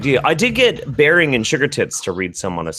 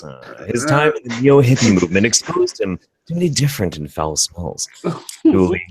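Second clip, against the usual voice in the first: ordinary talk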